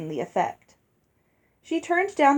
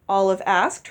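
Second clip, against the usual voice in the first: ordinary delivery, nothing marked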